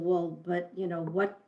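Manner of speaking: ordinary talk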